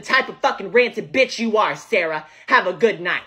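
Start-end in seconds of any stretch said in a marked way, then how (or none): none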